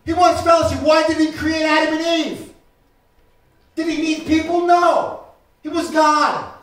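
loud, high, driving sermon shout; preaching at full force. Acted. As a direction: preach it out to the whole congregation.

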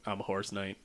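low, very quiet deadpan; deadpan introduction. Retold or imitated. Horse knight introducing himself as it shows up, deadpan. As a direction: drop it low and soft, underplayed.

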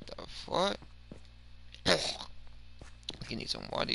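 normal talking voice

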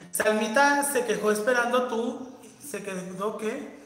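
normal talking voice

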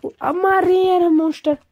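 real gamer excitement, loud and bright; excited cheer. Real, not acted. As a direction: cheer the pets on the kill. Pets kill the monster.

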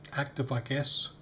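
ordinary talk

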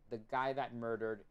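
ordinary delivery

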